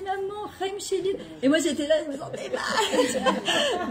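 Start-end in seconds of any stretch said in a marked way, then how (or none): 0.0-1.1 daughter's voice. Retold or imitated. 2.1-3.9 higher, slow, laughing through the words. Real, not acted. Laughs while telling.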